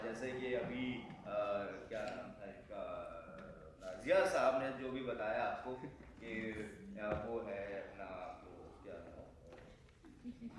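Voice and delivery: soft, low, slow groping for words; forgetful fumbling. Acted. Forgetful lecturer searching for a name, halting and quiet. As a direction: trail into absent-minded half-mumbled searching.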